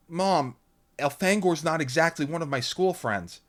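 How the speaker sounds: put-on a girl pressing her mom; a lightly voiced uneasy teen; Lauren. A narrator's reading. Lauren protests.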